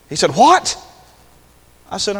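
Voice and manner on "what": higher, rising "what?"; mock-startled, pitch jumping up; startled question. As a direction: imitate a startled man just woken. Woken stepfather reacts, startled.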